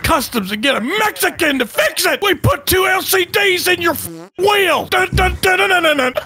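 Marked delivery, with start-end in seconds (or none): none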